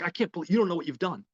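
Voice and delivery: high, hurried mock dismay; Harry. Harry scolds the man who called police, dismayed. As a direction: imitate a boss in a panic, rushing.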